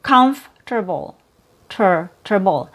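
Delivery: "comfortable" as "cumf-ter-bul" with an audible R; "comfortable" in three syllables, ending "ter-bul"; R sound. Shown as a demonstration. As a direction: put an R in the middle syllable, "terble". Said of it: "Sometimes you hear an R sound".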